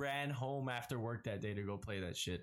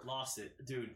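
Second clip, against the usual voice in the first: ordinary delivery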